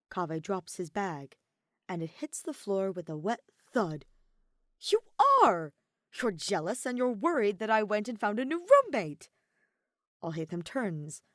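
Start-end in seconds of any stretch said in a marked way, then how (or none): 4.8-9.3 louder, gleeful gotcha; triumphant accusation. A narrator's reading. Kaveh gleefully catching Alhaitham out, triumphant. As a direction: pounce on the discovery.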